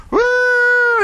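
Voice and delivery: high fake wailing shout; hammed-up child wail; mock wail. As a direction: kids hamming up crying.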